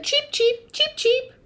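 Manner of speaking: high, short cheeps; sparrow cheeping. A narrator's reading. Sparrow in the noisy recap, high cheeping. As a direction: cheep in quick high pairs. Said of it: "The sparrow said".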